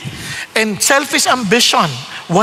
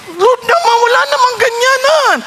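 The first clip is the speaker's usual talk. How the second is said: whiny protest; 10 semitones higher than usual. Retold or imitated. Pleading with God when called.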